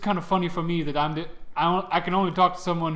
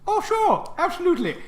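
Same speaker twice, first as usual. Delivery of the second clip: very high, airy agreement from a god; high-pitched, obliging deity; breezy god voice; 13 semitones higher, 4 dB louder than usual. Acted. God readily agrees to fix locks.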